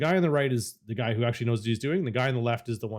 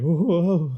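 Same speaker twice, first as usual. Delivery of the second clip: imitated wobbly "oh, oh, oh", higher and louder; alarmed pilot; 6 semitones higher, 6 dB louder, slower than usual. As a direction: play a rattled pilot's exclamations. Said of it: "He's like".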